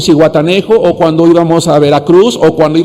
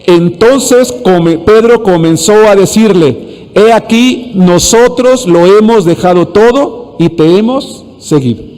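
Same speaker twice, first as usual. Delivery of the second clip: congregational reading; 3 semitones higher, slower than usual. Shown as a demonstration. Preacher leading everyone in reading Scripture together, slow and deliberate. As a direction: lead the verse word by word, very slowly. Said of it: "Dice así todos juntos".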